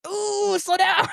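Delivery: high yelp of sudden realization; too late, catch yourself in a high ooh; sudden alarm. Retold or imitated. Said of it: "all of a sudden he's like".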